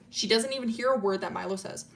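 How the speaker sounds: plain reading voice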